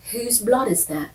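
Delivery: playful mock British question; British accent. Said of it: "He's not British, but she is".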